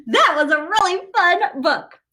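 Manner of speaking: usual speaking voice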